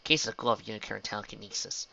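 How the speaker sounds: usual speaking voice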